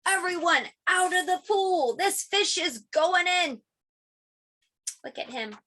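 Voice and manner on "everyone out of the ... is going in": yelling. Acted. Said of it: "The fireman yelled".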